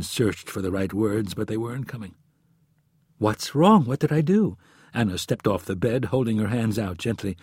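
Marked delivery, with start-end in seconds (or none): 3.2-4.6 worried. Acted. Anna worried, pleading.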